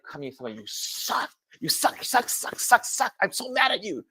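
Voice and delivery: high, half-whispered sulking chant; petulant, childish repeats; mock tantrum. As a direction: playfully sulk at God. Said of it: "But I was like".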